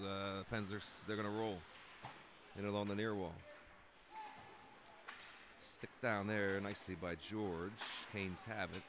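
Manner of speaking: brisk running hockey commentary; live play-by-play. Acted. Commentator calling the game for viewers, brisk play-by-play. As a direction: call the action as it happens.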